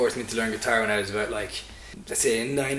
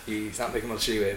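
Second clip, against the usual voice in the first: normal talking voice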